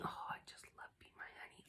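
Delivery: hushed unvoiced whisper about a beloved scent. Real, not acted. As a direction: whisper it like a secret treasure. Gushing over a favourite scent.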